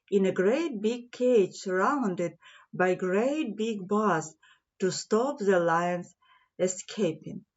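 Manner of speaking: ordinary delivery, nothing marked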